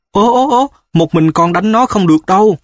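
high, shocked exclamation; astonished. A narrator's reading. A startled mother tells her son he can't fight alone. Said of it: "Mẹ Núp kinh ngạc".

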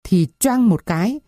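plain reading voice

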